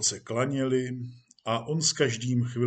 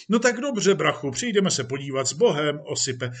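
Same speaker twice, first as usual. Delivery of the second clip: jovial police chief; 3 semitones higher, 4 dB louder than usual. Acted. Police chief says goodbye to actor, jovially. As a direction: send an old pal off breezily.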